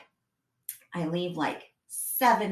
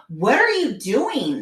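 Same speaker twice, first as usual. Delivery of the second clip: loud, drawn-out, swooping disbelief; exasperated scolding; 3 semitones higher, 9 dB louder, slower than usual. Retold or imitated. Mom whose child goes the opposite way, exasperated. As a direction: demand it loudly, stretching the words. Daughter walks the wrong way.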